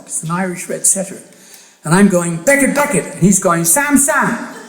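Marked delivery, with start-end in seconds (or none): 2.4-3.1 high, loud call across the beach; calling the dog. Retold or imitated. 3.6-4.5 high call across the beach, breaking into a laugh; calling the dog. Retold or imitated. Other man calls his dog.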